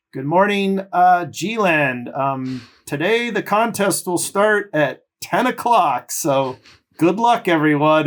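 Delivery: high, drawn-out Australian announcer on the mic; Australian accent. Retold or imitated. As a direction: call it out slowly and loudly in an Australian accent. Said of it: "in his Australian accent".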